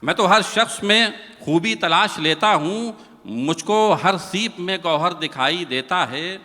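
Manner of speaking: measured, lilting verse delivery; poem recitation. Acted. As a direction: recite it like a mushaira couplet.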